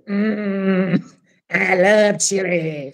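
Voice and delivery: slow, drawn-out slimy monster; very slow slimy snail voice. Acted. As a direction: savour it like a slimy slug monster. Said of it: "said the slug monster underneath".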